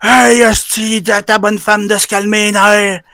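high, drawn-out mock yell; retold shout. The angry driver yelling at the neighbour, a slow, high mock yell. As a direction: stretch the words out slowly, like a guy puffing his chest. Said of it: "Là, il crie".

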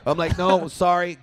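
usual speaking voice

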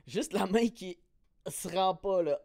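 ordinary talk

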